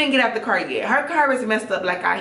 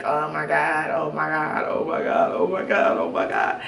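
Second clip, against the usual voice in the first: muttered panic; 3 semitones lower than usual. Retold or imitated. Anxious repeating thoughts at home.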